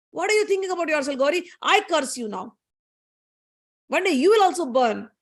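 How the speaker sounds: angry rebuke. Acted. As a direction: act out an offended mother's curse. Goddesses quarrel, Lakshmi curses Gauri.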